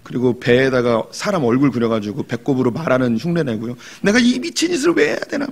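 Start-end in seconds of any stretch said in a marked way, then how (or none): 4.0-5.5 much higher, fed-up whine; mock despair at his own antics; exasperated thought. Young teacher fed up while clowning for kids, high whine.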